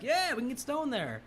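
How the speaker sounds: high, goofy stoner reply; stoner teen. Retold or imitated. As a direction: say it like a dopey stoner. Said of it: "They're like".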